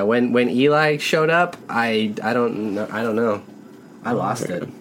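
usual speaking voice

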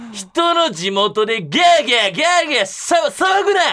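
high, loud furious Osaka rant; angry drunk yell. Retold or imitated. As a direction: yell at them like a drunk local. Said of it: "とか言って". (酔っ払いのおっさんの怒鳴り声)

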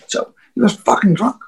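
ordinary talk

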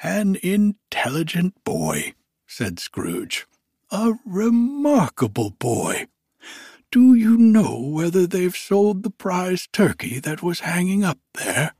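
put-on high, loud, elated old miser; giddy old Scrooge. Scrooge overjoyed on Christmas morning, high giddy voice. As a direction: bubble over with joy as old Scrooge.

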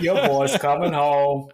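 loud movie-style southern drawl; mock southern accent. Quoting Con Air to tease a friend, hammed-up southern accent. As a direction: ham up a bad southern accent. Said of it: "his southern accent was on point".

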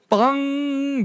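loud, high, drawn-out bong; imitated metallic bong. Sister hits the pole, imitated ringing bong. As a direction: ring out like a struck hollow pole. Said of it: "if it had been hollow".

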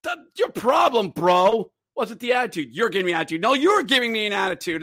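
high, loud mock shouting at a rival; angry player. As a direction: imitate a hot-headed player yelling "bro". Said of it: "And Ora's like".

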